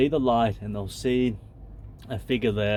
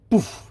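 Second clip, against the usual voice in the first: mock spell poof; 9 semitones higher, 7 dB louder than usual. Casting a pretend love spell.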